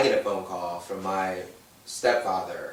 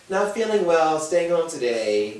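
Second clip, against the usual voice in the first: higher, louder mock-pitiful whine; mock sick post; 8 semitones higher, 6 dB louder than usual. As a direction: overplay being poorly. Mock Facebook status.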